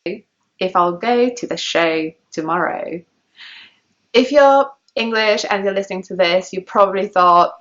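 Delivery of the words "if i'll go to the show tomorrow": slow, GO, SHOW and TOMORROW with "eh-oo"; received-pronunciation O. Shown as a demonstration. Teacher models sentence's second half slowly, RP O in GO, SHOW. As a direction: draw out fronted O in GO, SHOW, TOMORROW. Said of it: "to practice this sound".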